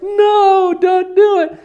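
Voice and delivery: high, loud panicked plea; freaking out. Pretend. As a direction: beg them to stop in a panic. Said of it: "they're freaking out".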